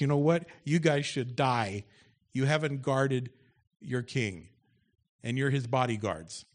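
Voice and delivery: loud preaching read of the story; loud scripture reading. Acted. Pastor reads David's taunt aloud to the congregation, loud and forceful. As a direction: boom the scripture out to the church.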